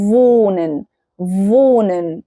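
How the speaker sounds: "wohnen" starting with English V, long o, silent h; slow, low "wohnen" as VOH-nen; V sound. Shown as a demonstration. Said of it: "فالنطق بتاعه". ("wohnen" بصوت V والـ o ممدودة)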